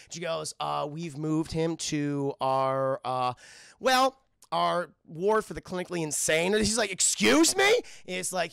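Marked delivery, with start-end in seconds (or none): 0.6-6.5 slow, halting reluctant reveal; drawn-out awkward hesitation; hesitant nurse. Acted. Nurse awkwardly breaks bad news. 6.9-7.8 high, loud rising question; outraged double-take, pitch shooting up; incredulous. Acted. Visitor shocked at news.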